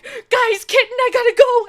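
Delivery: high, loud hurried shout; excited rush. Retold or imitated. Telling D and D friends she must leave, loud excited shout. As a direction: blurt it out as you run off. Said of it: "And I'm like".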